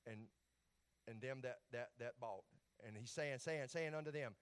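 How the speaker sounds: usual speaking voice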